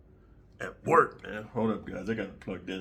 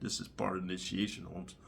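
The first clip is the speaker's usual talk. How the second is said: softer, half-whispered Chicano coaxing; 4 semitones lower, 10 dB quieter than usual. Retold or imitated. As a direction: coax him gently like a gang member. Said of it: "talking about".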